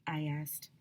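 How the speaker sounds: whispered aside. A narrator's reading. Narrator whispering to the listeners. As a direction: breathe it under your breath. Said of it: "whispered aside".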